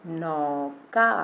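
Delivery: नौका very slow, with a long औ; taking time. Shown as a demonstration. Teacher shows the long vowel, नौका drawn out. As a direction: stretch both syllables of नौका. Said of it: "देखिए last में औ निकल रहा है".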